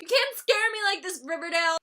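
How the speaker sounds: loud, high mock scold; scolding the show. Vlogger telling the show off, playful and loud. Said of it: "I was like".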